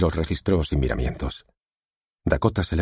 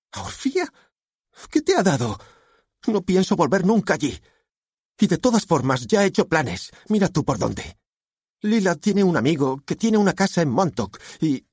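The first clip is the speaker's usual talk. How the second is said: put-on high-pitched indignant teenager; outraged protest, pitched way up; defiant daughter. A narrator's reading. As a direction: argue back in a high voice. Defiant daughter argues with father.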